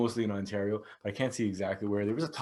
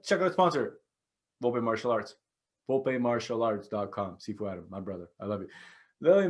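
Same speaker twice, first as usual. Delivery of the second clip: usual speaking voice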